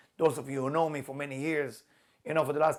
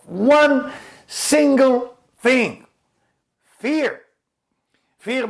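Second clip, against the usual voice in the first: slow, loud build to the word Fear; preaching at full force; 6 semitones higher, 9 dB louder, slower than usual. Acted. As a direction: hammer home the warning like a preacher. Preaching the main point.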